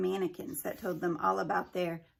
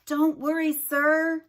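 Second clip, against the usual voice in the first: eager reassurance; 7 semitones higher, 8 dB louder, slower than usual. A narrator's reading. Haley reassures Limoncello via camera.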